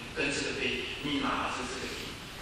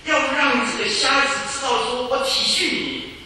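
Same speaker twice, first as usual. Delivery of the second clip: higher and louder, pleading preacher; fervent preaching; 10 semitones higher, 8 dB louder than usual. Acted. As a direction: proclaim his compassion with fire. Fervent preaching of Jesus' care.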